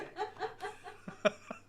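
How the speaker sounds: high-pitched laughing fit; high giggle. Real, not acted. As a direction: squeal of laughter. Laughing after announcement.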